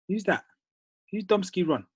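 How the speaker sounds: ordinary talk, nothing marked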